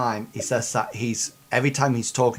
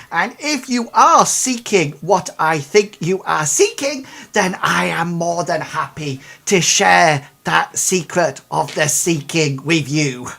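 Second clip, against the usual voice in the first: excited; 4 semitones higher, 7 dB louder than usual. Acted. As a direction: gush with eager delight. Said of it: "bursts up".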